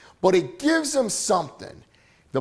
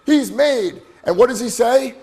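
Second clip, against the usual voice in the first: excited; 6 semitones higher, 8 dB louder than usual. Real, not acted. Peak of Stallone story.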